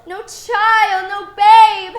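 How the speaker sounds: loud stage delivery, slightly sung; projected. Acted. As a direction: project to the back row. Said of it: "That's it".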